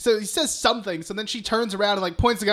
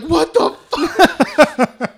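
drawn-out, much louder and higher; a slow, loud, laughing "what the fuck" as the girl crashes into the pool; shocked outburst; 8 semitones higher, 11 dB louder, much slower than usual. Retold or imitated. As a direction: blurt it out slowly in disbelief, laughing through it. Rick startled by girl crashing in.

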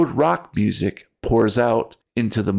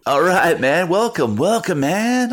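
stoner bus driver voice; 9 semitones higher, 3 dB louder than usual. Acted.

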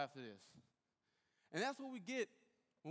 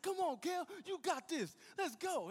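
hype-man cheer; 11 semitones higher, 4 dB louder than usual. Retold or imitated. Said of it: "He's like".

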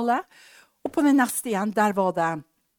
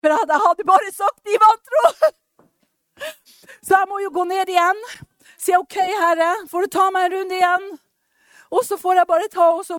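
laughing, near tears; 9 semitones higher, 8 dB louder than usual. Real, not acted. Laughing and tearful retelling.

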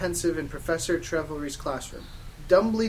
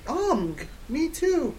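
high, drawn-out gushing; slow squeal of delighted agreement; happy; 11 semitones higher, much slower than usual. Acted. Girl thrilled to share his band, happy. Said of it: "I replied happily".

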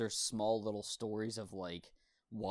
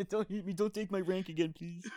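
higher whimpering plea; mock pleading; 9 semitones higher than usual. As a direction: beg the master, hammed up. Pleading with abusive master.

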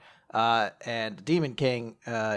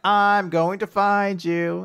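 slow; 5 semitones higher, 5 dB louder, much slower than usual. Pretend. Gelda's determined line.

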